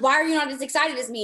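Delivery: high, fast, mock-offended question; mock indignant whine. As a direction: play up being let down, fast. Retold complaint to teammate.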